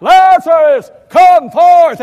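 drawn-out, high, loud summons; loud cry. Acted. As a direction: call the dead man out of the tomb. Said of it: "and Jesus cried out".

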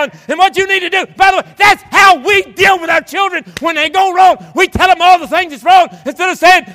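high, loud pulpit shout; full-force preaching. Real, not acted. Preacher to congregation on raising children, full-force preaching. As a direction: drive the point home at full preaching volume.

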